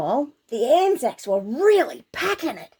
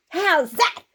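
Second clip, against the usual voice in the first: mild mock shout; 5 semitones higher than usual. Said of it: "shouted all the Anzacs with one voice".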